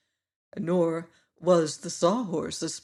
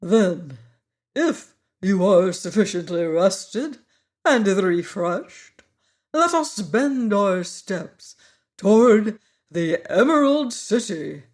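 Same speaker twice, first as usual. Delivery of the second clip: grand, slow insect professor voice; proud Wogglebug showing off his coat; pompous Wogglebug; 3 semitones higher, 7 dB louder than usual. A narrator's reading. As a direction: speak like a self-important bug. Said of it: "suggested the Magnified One".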